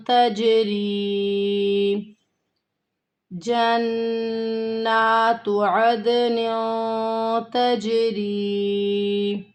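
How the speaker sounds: slow, drawn-out melodic tajweed recitation; Quran recitation. Shown as a demonstration. Teacher recites the verse for her students, slow and melodic. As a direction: chant each word long and held.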